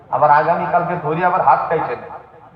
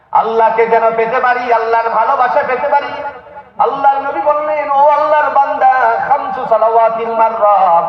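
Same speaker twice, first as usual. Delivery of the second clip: high, sustained sermon pitch; impassioned preaching; 10 semitones higher, 4 dB louder than usual. Acted. Preacher retelling Bedouin's plea and Prophet's answer, high impassioned preaching. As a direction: lift the voice high and preach the story.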